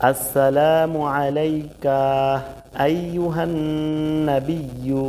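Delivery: slow, careful Arabic recitation, each syllable drawn out. Shown as a demonstration. Teacher reciting Arabic slowly for learners. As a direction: recite it slowly for students to copy.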